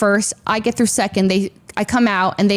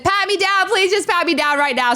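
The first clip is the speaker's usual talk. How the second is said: urgent plea; 8 semitones higher than usual. Retold or imitated. Begging to be searched quickly.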